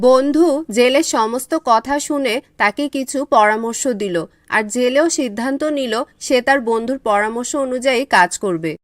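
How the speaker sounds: low, even storyteller voice; narrating a village folk tale; story narration. A narrator's reading. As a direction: tell the tale calmly to listeners. Narration of fisherman's day.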